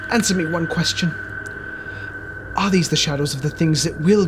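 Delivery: desperate plea. Acted. Scrooge pleading with the silent ghost, desperate. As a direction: beg the spirit for an answer.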